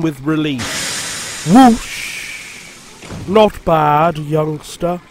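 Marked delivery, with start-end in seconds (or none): 1.5-5.0 lofty. A narrator's reading. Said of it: "said Henry loftily".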